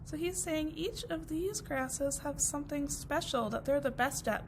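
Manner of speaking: ordinary delivery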